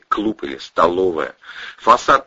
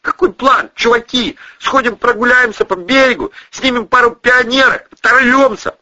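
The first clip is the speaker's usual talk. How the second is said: high, loud, wound-up chatter; hysterical banter; 5 dB louder than usual. A narrator's reading. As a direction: pitch a wild plan to the gang. Hysterical character keeps talking, acted.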